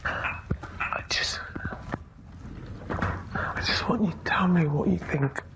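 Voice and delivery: whispered through tears, voice barely there; tearful whisper. Real, not acted. Housemate whispering to a friend about being upset, tearful. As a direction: whisper it, holding back crying. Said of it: "I'm gonna stop crying again".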